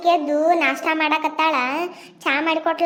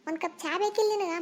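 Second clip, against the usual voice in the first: ordinary talk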